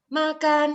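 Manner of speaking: MAKAN held high and loud; high level tune. Shown as a demonstration. Teacher showing students tune changes no meaning, high makan. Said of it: "nggak ada bedanya ya".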